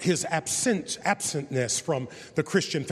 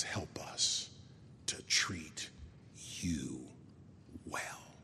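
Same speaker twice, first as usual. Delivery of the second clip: breathy whisper, softer still; whispered prayer; 10 semitones lower, 13 dB quieter, much slower than usual. Retold or imitated. Preacher repeating her prayer as a soft whisper.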